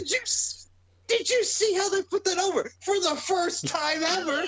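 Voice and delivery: high-pitched sarcastic hype; mock amazement. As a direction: gush in fake astonishment, voice way up. Mocking commentators' hype of the unmasking.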